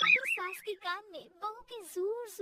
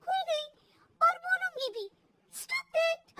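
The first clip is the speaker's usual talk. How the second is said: higher-pitched cheeky retort; defiant back-talk; 6 semitones higher than usual. Acted. Daughter-in-law defies mother-in-law, cheeky. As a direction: answer back in a raised pitch.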